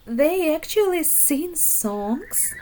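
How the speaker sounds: high whispered disbelief; amazed whisper. Acted. Lemon amazed at singing frogs.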